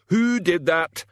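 high, loud furious questioning; angry demand. A narrator's reading. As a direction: demand the culprit, losing your temper. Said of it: "demanded Medium Dave".

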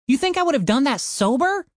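high, whining defensive voice; whiny high protest. Acted. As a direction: plead innocence in a high whine. Tim excusing himself.

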